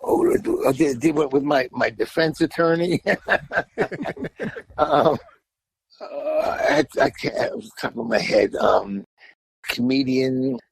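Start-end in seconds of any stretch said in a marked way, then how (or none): 3.1-4.7 short run of chuckles; real, spontaneous laughter; amused laugh. Real, not acted. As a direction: laugh at your own joke. Chuckles at his own joke.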